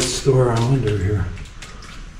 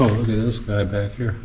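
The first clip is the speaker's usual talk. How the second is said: normal talking voice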